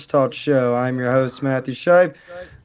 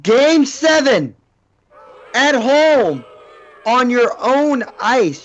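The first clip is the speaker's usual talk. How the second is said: angry rant; 7 semitones higher, 4 dB louder, slower than usual. Real, not acted. Fan rants about game seven loss.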